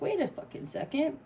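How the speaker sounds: mock realization. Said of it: "you're like".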